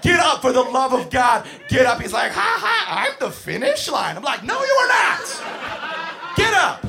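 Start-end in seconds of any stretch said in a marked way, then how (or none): 0.0-2.0 desperate shout. Retold or imitated. Yelling at kid. 2.3-4.2 put-on giggly kid. 4.4-6.8 slow stern shout. Retold or imitated. Yelling at kid.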